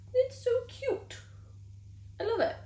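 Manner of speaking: high-pitched delighted squeal; cute squeal. Real, not acted. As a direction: squeal over something adorable. Squealing over cute book.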